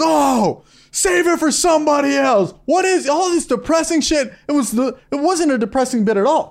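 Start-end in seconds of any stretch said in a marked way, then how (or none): 0.0-4.3 high, mocking heckler shout; hammed-up heckling from the front row. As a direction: sneer it like a heckler on shrooms. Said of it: "he goes".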